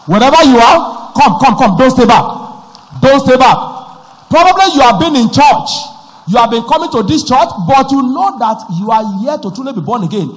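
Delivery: loud, rousing preacher's call; fervent altar call. Acted. Pastor calling worshippers to the altar, fervent and loud. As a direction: urge them forward with full force.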